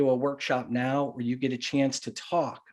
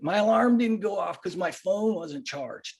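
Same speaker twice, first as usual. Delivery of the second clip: higher-pitched put-on teenage movie-nerd voice; Napoleon Dynamite; 6 semitones higher than usual. Kid excusing lateness to a parent, Napoleon Dynamite impression. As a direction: imitate a whiny teen movie character, pitched up. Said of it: "I have to do my Napoleon Dynamite voice".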